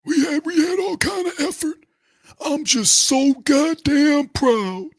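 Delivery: mock sobbing falsetto; mock whiny player. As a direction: whine like a self-pitying loser. Mock player whining about effort.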